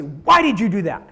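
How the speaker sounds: loud, confrontational demand; accusing question. Shown as a demonstration. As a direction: fire the question at him to put him on the spot. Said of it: "Scott, if I say".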